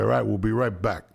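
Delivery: ordinary talk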